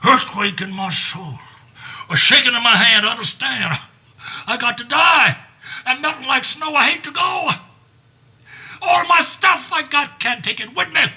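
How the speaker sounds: high, loud anguished cry; dying king shouting his lament; wailing old king. Acted. Lear wails about dying.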